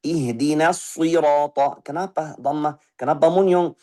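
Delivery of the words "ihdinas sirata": slow Ihdinas sirata with lips rounded; with ismam. Shown as a demonstration. Teacher shows the wrong rounded-lip reading, slow. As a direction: sirata with lips pushed forward. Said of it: "tambah ismam".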